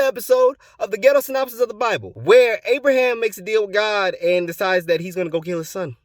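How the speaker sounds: loud, high hammed-up episode teaser; show announcer. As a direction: belt out the teaser far above normal talk. Sign-off line of the comedy show.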